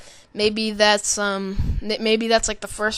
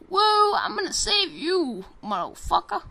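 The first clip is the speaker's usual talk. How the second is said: slow, much higher hammed-up hero voice; mock soldier; 10 semitones higher than usual. Gamer mocking trailer soldiers, slow high hammed-up voice. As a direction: ham up a cheesy action hero. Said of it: "and then they're like".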